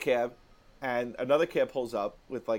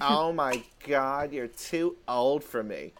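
put-on dismissive girl; slower than usual. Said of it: "And she goes".

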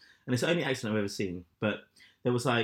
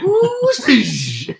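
high, loud mock gasp of admiration; impressed drivers; 15 dB louder than usual. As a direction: play a gawking driver wowed by the hair. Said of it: "they're driving by and they're like".

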